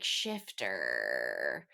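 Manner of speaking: the bloodhound sneers one word at Fenrys; creature voice. Retold or imitated.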